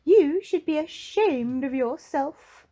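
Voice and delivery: high, loud indignant scolding; Little Miss Splendid crying out. Acted. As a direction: cry out in prim outrage. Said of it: "cried Little Miss Splendid".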